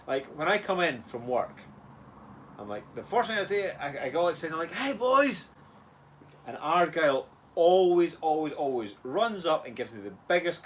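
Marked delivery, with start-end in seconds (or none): 4.7-5.5 high acted hello; cheery call. Dad acting out greeting his boys after work, cheery call. Dad calling out on coming home.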